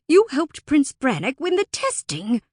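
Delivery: high, loud interruption; angry accusation. A narrator's reading. Fae interrupts Alora's protest, angrily accusing. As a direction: cut in with heated blame.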